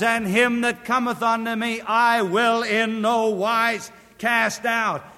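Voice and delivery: high and loud, proclaimed to the congregation; preacher calling out the verse in full voice; ringing proclamation. Acted. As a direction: announce the promise from the pulpit. First proclaimed reading of the verse.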